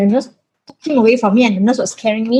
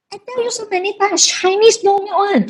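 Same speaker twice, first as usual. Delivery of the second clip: mock mother scolding; 10 semitones higher, 3 dB quieter than usual. Mother lecturing daughter.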